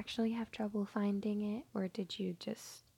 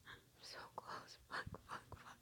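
breathless, hushed whisper; breathy whisper; 15 dB quieter than usual. Acted. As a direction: barely breathe the words. Intimate breathless moment.

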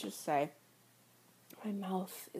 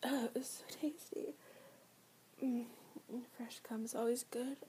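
satisfied soft whisper; 6 dB quieter than usual. Acted. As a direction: savour it in a whisper. Whispered satisfied roleplay line.

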